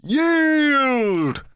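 high, drawn-out battle yell. A narrator's reading. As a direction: yell for surrender. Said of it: "the knight yelled".

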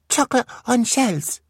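put-on high eager little-boy voice; a tiny child reaching for treats; toddler voice. Beckett mishears shelves, high and eager.

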